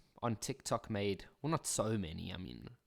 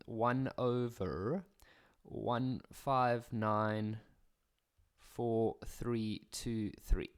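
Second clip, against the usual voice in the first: slow dictation; much slower than usual. Shown as a demonstration. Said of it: "dictating digits slowly".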